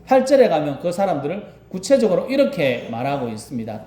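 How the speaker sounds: ordinary delivery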